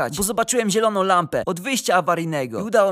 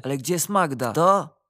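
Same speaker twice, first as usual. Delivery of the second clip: plain reading voice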